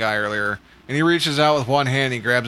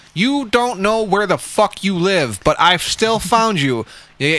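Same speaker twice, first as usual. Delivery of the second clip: raised, higher pitch, drawn-out indignant emphasis; pissed-off rant; 7 semitones higher, 4 dB louder, slower than usual. Retold or imitated. Driver to customer who gave the wrong address, pissed off. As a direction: lay into them, stretching out each accusation. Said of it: "I was so pissed".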